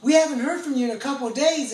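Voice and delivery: loud, high worried call; concerned call. Retold or imitated. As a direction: call up to a friend you haven't heard from. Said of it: "They're like".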